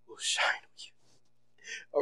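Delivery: slow whispered scripture close. Acted. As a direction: let the promise fade to a slow whisper. Scripture reading hushed.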